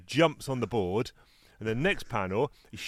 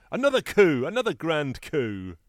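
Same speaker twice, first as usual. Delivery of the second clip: high, loud gloating call; triumphant crowing; 6 semitones higher, 5 dB louder than usual. Acted. Lucy running off after whacking Charlie Brown, gloating. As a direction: crow the victory, starting high and loud.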